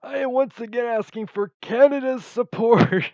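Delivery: high, very loud put-on plea; put-on orator. Vlogger jokingly appealing to Canadian viewers, loud put-on voice. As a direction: belt out a mock political appeal. Imitated plea after clearing throat.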